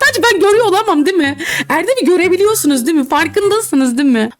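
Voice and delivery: quick incredulous laughing appeal; excited disbelief. Real, not acted. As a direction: rush it out half-laughing, begging viewers to agree. Exasperated appeal to viewers.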